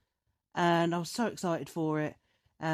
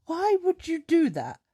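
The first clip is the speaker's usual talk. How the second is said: high, drawn-out disbelief; acted-out bewildered complaint; incredulous exasperation; 10 semitones higher, 4 dB louder than usual. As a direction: stretch the question out, baffled and exasperated. Her lasting disbelief, acted out.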